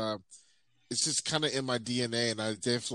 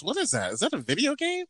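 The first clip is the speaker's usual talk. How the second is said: puzzled wife, jumping much higher on the question; imitating wife; 10 semitones higher, faster than usual. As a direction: play the baffled wife. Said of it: "She goes".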